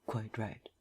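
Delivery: a soft, amused murmur; barely voiced, quietly amused agreement. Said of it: "murmured Vance".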